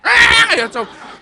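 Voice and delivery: high, loud, drawn-out screech; imitated cat scream. Live cat in the pitcher's mouth, screaming. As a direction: screech like a cat being chewed alive. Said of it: "I heard a scream".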